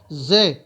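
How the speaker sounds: firm, loud letter demo; harder zay. Shown as a demonstration. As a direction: punch the z of زے with some force. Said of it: "تھوڑا سختی کے ساتھ".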